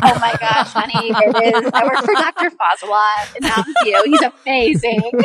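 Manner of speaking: slightly high, delighted laughing brag; laughing gush. Real, not acted. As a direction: gush through a real laugh. Gushing about her dream job, laughing.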